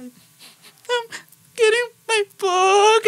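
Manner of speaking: high, tearful voice, close to sobbing; crying. Retold or imitated. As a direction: choke the words out through tears. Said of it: "trying to hold back the tears".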